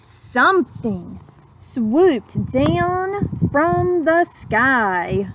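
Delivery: put-on slow, lilting read-aloud to children; gentle rhyming storyteller; storybook narration. A narrator's reading. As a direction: savour each word like a bedtime story. Rhyming picture-book narration.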